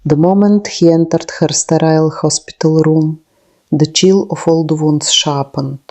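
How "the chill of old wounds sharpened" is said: ordinary delivery, nothing marked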